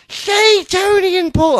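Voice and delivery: very high, loud put-on shout; high shouted read-out. Host restarts a listener's email for co-host, high mock shout. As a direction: yell the greeting at the top of your range.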